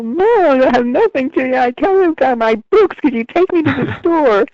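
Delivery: put-on high, whiny kid pleading with mom; imitated child's whine. Shown as a demonstration. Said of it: "could you use your other voice".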